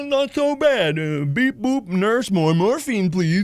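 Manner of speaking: high, slow morphine daze; drugged patient. Retold or imitated. Patient asking nurse for morphine, high dazed slur. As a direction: mumble happily as if doped up. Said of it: "that, that, that was me".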